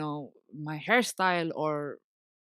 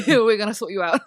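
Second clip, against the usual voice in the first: laughing quote; 4 semitones higher, 8 dB louder than usual. Retold or imitated. Friends' threat quoted laughingly.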